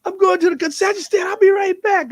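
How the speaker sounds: high, loud call over the shoulder; runaway friend shouts back an excuse; shouted excuse. Retold or imitated. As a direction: yell the excuse while running off. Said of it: "He's like".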